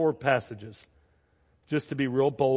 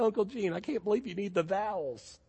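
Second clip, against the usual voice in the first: put-on child's voice; 7 semitones higher, 3 dB quieter than usual. Child quoted again, higher.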